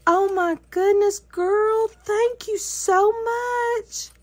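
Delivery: high, delighted gushing, slowing on thank you; thrilled. Real, not acted. To her crafting friend, opening the gift: thrilled high gushing. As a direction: squeal of grateful delight.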